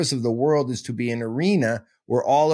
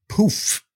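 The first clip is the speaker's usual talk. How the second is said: poof sound; 9 semitones higher than usual. Shown as a demonstration. His chosen poof sound effect.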